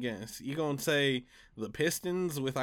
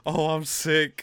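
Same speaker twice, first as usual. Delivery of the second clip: anguished laugh; 3 semitones higher, 6 dB louder, slower than usual. Real, not acted. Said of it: "hear the pain in my voice".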